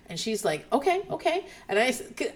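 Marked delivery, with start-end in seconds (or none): 0.7-1.5 high-pitched imitated teacher okays; talking around someone. Teacher answering the whispering student, high retold acknowledgement. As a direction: acknowledge a child's prompting in a high voice. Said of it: "she is talking around someone".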